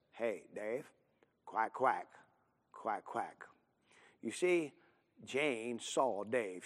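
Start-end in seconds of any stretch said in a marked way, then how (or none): 0.1-3.5 quiet teasing quack. Retold or imitated. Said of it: "and she says".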